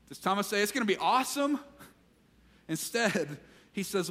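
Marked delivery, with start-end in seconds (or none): 0.6-1.6 high, hyped-up excitement; mock enthusiasm. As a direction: play up the fake excitement. Mock quote Thomas did not say.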